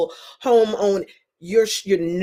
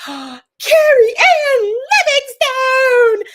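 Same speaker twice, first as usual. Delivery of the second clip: big high drawn-out squeal of a greeting; gasp, then sing out the name loud and high; excited shout; 10 dB louder, much slower than usual. Real, not acted. Host spots a friend in the live chat, thrilled shriek.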